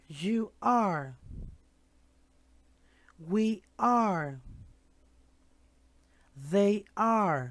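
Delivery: "You are", "We are", "They are" said clearly; pronunciation drill. Shown as a demonstration. Teacher drilling plural "are" with the class. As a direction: model each plural with "are". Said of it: "Now we're going to practice the pronunciation".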